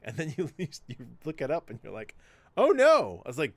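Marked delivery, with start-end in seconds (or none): 2.6-3.2 high, loud oh no; realizing bad writing was real history; dismayed realization. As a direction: cry out in mock dismay. Realizing it truly happened.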